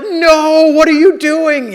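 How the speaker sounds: high, loud outraged shout; shocked yell. Retold or imitated. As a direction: keep shouting at the whole class in disbelief. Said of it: "And he's like".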